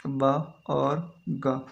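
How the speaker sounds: slow sounding out of बा और गा; teacher slowly sounds out बा and गा for the class; sounding out consonants. Shown as a demonstration. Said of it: "बा और गा दो कॉन्सोनेंट साउंड है".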